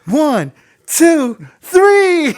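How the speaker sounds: high, loud countdown building to a shouted peak; happy little kid. Retold or imitated. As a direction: imitate an over-excited kid MC rallying the crowd. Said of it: "He sounds like a little kid just happy to be there".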